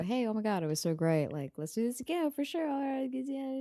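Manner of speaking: soft, rising fake enthusiasm; mock polite goodbye. Imitating how all dates end, politely fake enthusiasm. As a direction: trail up into an insincere yeah. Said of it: "We always go".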